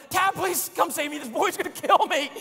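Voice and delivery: real-sounding desperate plea; desperate yell. Retold or imitated. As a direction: beg the wife to come save you. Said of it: "It was more like a".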